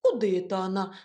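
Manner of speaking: usual speaking voice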